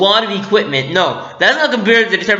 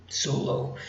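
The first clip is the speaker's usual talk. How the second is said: very soft, near-whispered answer; hushed reply; 13 dB quieter than usual. Retold or imitated. Fan acting Kylo Ren answering his father, hushed. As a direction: answer a shout in a hush. Kylo's cold reply to Han.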